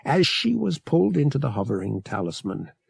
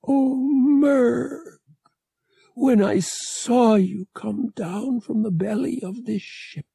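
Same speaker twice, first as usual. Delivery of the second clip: higher, strange-timbred female alien voice; tender alien mate; 10 semitones higher than usual. Acted. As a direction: speak to him with worried love.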